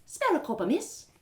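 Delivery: a lightly voiced street beggar asking; beggar woman. Beggar woman asks narrator for a copper, pleading.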